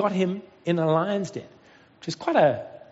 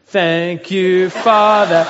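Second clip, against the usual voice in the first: loud, drawn-out mock child's prayer; praying girl; 2 semitones higher, 10 dB louder, much slower than usual. Josie praying aloud for her hurt sister, loudly hammed-up. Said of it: "So then Josie goes".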